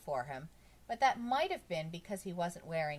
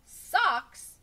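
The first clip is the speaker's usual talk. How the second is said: very loud, high yell; sudden shout; 12 semitones higher, 14 dB louder than usual. A narrator's reading. Bob fed up with questions about socks, shouting. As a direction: explode on the word, much louder. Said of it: "Bob shouted so loud it scared everybody".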